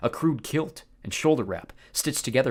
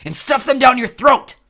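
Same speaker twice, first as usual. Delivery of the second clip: loud, high insult; furious yell; 13 semitones higher, 14 dB louder than usual. Acted. Clarice insulting the Cyclops, loud yell. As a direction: spit the insult up at him.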